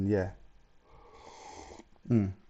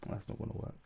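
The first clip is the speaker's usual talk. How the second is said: under breath; 13 dB quieter than usual. Real, not acted. Muttering to self while setting up.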